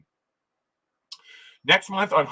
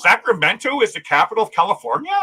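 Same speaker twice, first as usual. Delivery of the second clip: feigned amazement, pitched up; mock surprise; 6 semitones higher, 5 dB louder than usual. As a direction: play it as news to you.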